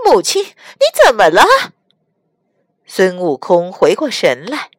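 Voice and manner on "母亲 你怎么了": loud, high, sudden alarm; startled alarm. Acted. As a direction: blurt it out in shock. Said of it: "白骨精大惊道".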